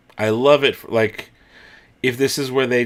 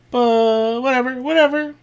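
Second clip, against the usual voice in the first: dismissive; 13 semitones higher, 3 dB louder than usual. Real, not acted. Dismissive high whatever.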